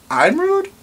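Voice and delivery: mock scandalized; outraged disbelief. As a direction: pitch up in shocked outrage. Repeated, even higher.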